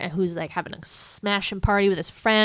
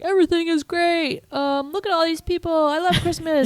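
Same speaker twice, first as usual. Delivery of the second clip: high, hammed-up beaming cheer; mock euphoric; 10 semitones higher than usual. Retelling Scrooge interrupting the broadcast, mock euphoric. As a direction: announce it like joy overflows.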